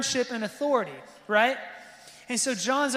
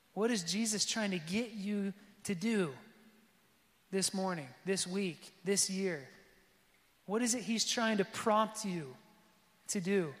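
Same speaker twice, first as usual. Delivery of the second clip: softer, drawn-in closing plea; intimate appeal; 2 semitones lower, 6 dB quieter than usual. Acted. Pastor appealing to the congregation, softer and intimate. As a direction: pull them close and soften.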